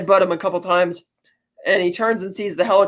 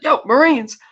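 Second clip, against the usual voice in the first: calling out; 6 semitones higher, slower than usual. Acted. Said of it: "He's like".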